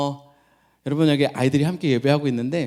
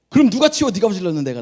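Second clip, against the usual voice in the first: high, louder mock scolding of a child; exasperated scold; 7 semitones higher, 8 dB louder than usual. Tired parent scolding the child who made the mess, exasperated. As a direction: snap the question out in exasperation.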